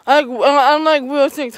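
usual speaking voice

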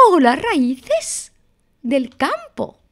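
high, slow mouse greeting; ratón muy bajo. Acted. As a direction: stretch out the hello in a high character voice. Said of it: "Y ratón muy bajo decía". (saludo agudo y lento de ratón)

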